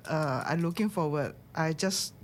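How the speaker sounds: normal talking voice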